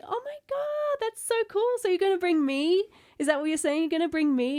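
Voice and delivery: high, loud excited squealing. Retold or imitated. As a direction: retell the thrill of free tickets. Retold excitement at brother's news.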